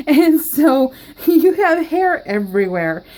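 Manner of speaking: giggling at her own joke; amused giggly telling; laughing. Real, not acted. Laughing at her dog-hair joke.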